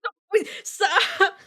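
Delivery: dabbing tears while laughing; slow, high, laughing helplessly; laugh-crying. Real, not acted. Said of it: "I hurt".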